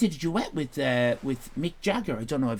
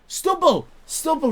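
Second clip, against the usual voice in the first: found it; 12 semitones higher, 4 dB louder than usual. Real, not acted. Finally finds the lost word.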